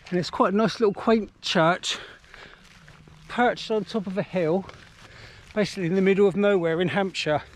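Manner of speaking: ordinary talk, nothing marked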